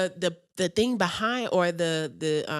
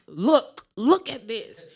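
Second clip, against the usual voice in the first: excited look; 3 semitones higher, 8 dB louder, slower than usual. Retold or imitated. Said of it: "she was like".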